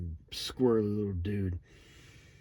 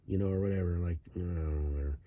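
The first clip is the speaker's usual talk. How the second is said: normal talking voice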